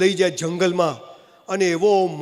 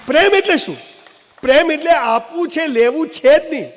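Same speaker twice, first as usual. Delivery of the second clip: emphatic; 6 semitones higher, 4 dB louder than usual. Acted. Comic speaker's loud punchline.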